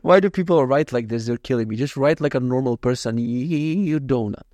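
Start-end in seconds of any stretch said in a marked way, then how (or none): none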